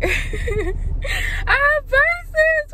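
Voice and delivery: high squealing laugh-cry over new braces; excited squeal. Real, not acted. Girl squealing to her viewers about new braces, thrilled. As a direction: squeal with real joy, pitch way up.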